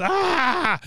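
high mock scream. Retelling how they all reacted, high mock scream. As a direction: imitate a group's drawn "ah". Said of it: "they're all just".

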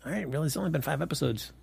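mock going along with it, quietly unconvinced; unimpressed shrug. Host telling co-host his reaction to Nate's speech, unimpressed.

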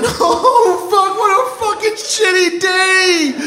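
long high-pitched group wail; mock communal howl of misery; everyone crying. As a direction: bawl it out, hammed up. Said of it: "I start to cry".

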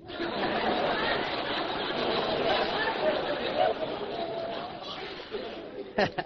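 high, airy burst of laughter; long high cackle; huge laugh. Real, not acted. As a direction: let out a wild laugh. Big laugh after joke.

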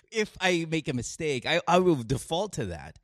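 ordinary talk, nothing marked

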